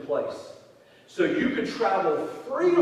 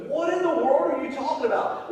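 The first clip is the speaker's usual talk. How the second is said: pitched higher in mock disbelief; incredulous; 9 semitones higher, faster than usual. Pastor retelling his confusion at his daughter's slang, incredulous. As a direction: let the confusion climb up high. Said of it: "I was like".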